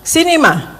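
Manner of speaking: a husband calling her over, a bit sharp; light mock of an annoyed husband calling his wife; unpleasant tone. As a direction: call her in with a hint of irritation. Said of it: "suaranya rada nggak enak".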